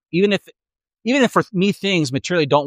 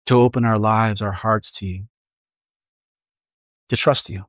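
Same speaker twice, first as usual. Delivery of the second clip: unhurried prayer with long pauses; a pastor's gentle closing prayer. Acted. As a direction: pray it aloud for the congregation.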